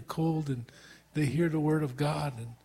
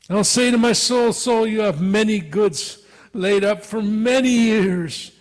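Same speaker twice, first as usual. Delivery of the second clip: boastful rich man; 4 semitones higher, 7 dB louder than usual. Retold or imitated. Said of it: "And then he says".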